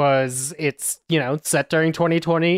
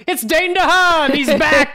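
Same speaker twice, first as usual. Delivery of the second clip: excited reveal; 13 semitones higher, 5 dB louder than usual. Real, not acted. Reveal of masked actor.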